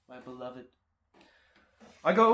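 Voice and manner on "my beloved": very soft, hushed adoration; mock tender. Car owner naming his beloved car, mock tender. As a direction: breathe it softly, like a love-struck devotee.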